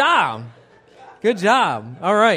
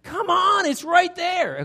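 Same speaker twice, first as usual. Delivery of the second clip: high, loud frustrated yell at the screen; exasperated high shout of come on; screaming at the TV; 13 semitones higher, 5 dB louder, slower than usual. Retold or imitated. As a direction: yell at a game show contestant who can't see it. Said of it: "I'm screaming at this screen like".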